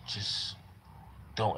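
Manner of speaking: hushed, breath-only "Just" between two soft "Don't"s; God's calming voice. Retold or imitated. God stopping him from punching the doctor, hushed. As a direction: quietly hold yourself back. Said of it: "God came over me and was like".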